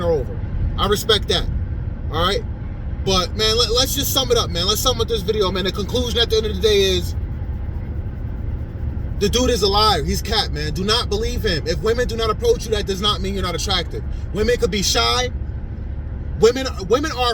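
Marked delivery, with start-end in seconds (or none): none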